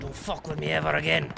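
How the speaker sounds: ordinary delivery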